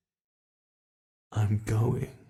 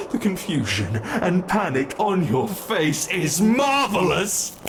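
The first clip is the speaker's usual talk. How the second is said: louder, higher, relishing every word; gleeful gloating; 6 semitones higher, 4 dB louder than usual. Acted. Monster delighting in the captive's panic, gleeful and loud. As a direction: savor their panic with rising delight.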